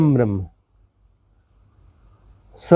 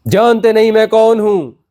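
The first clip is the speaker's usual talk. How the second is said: mock ego shout, higher and louder; arrogant boast; 6 semitones higher, 4 dB louder than usual. Guru mocking the ego's boast to a student, loud and higher. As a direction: puff up and bark it like a braggart. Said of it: "इसको और जोर से बोलोगे".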